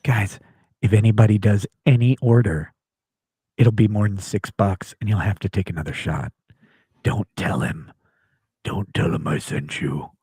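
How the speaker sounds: low, secretive aside to the audience; conspiratorial low voice. Pretend. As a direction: lean in and plot with the chat.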